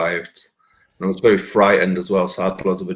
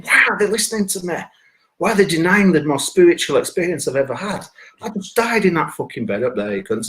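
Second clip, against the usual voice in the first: irate; 7 semitones higher than usual. Retold or imitated. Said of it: "I'm thinking".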